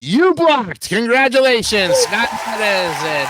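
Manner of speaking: bright, high congratulations; excited. Real, not acted. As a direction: cheer the win. Host celebrates a block.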